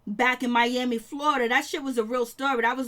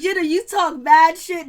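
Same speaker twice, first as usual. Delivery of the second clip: loud, laughing call-out; laughing shout; 5 semitones higher, 9 dB louder than usual. Real, not acted. Laughing loudly at Jennifer talking back on the show. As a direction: yell it through laughter. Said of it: "I was like".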